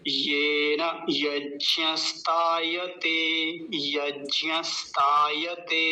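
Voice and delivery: येन यज्ञस्तायते very slowly, long ये and ता; measured teaching model of यज्ञस्तायते, every syllable drawn out; whole-word demo. Shown as a demonstration. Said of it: "पूरा शब्द देखिए कैसे बोला जाएगा". (येन यज्ञस्तायते धीरे, स्पष्ट)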